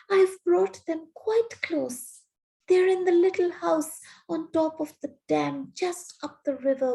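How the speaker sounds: put-on high, pleading, frightened boy; a boy's high, frightened pleading. A narrator's reading. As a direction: beg her, small and scared.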